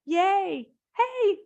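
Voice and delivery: high, loud joyful whoops; excited cheer. Shown as a demonstration. Teacher showing the class how to cheer out loud. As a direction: cheer out with abandon. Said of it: "so make a sound".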